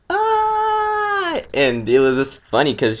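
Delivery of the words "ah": high-pitched imitated yelp of fright; mock high scream. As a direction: squeal it like a scared little girl. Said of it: "she was like".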